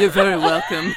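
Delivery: squealing high with laughter; giggling through the words; laughing. Real, not acted. Giddy laughter after gossip.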